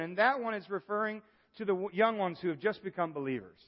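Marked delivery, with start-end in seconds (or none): none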